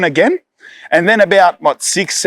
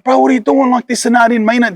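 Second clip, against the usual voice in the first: much higher, frightened pleading; scared kid pleading; 8 semitones higher than usual. Retold or imitated. Boy held at knifepoint begging the narrator, high frightened plea. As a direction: squeak it out like a terrified schoolboy. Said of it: "he's like".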